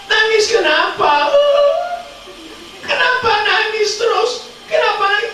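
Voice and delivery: high, urgent mother asking; worried mother. Acted. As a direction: press her daughter, voice way up. Mother asks crying daughter. (ibu cemas bertanya dengan suara tinggi)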